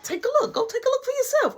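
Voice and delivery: high-pitched insistent friend voice; excited friend. Retold or imitated. Mark urging his friend to check the door, high and insistent. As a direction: push him to go look, voice up high.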